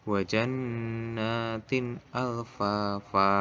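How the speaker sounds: "alfafa" ending on a long open a; drawn-out recitation closing "alfafa" on a held a; long final fathah. Shown as a demonstration. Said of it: "ini contohnya bawahnya lagi".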